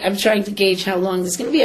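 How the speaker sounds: ordinary talk, nothing marked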